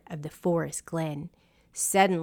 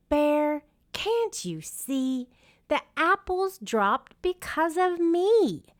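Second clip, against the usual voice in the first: high teasing wind voice; the wind showing off, pitched up; 10 semitones higher than usual. A narrator's reading. Wind character voice.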